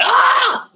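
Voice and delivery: sudden very high, loud "Ah!" shriek; mimicking his emotional mom's outburst with a high yell. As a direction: imitate mom flipping out with a piercing yell. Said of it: "she's like".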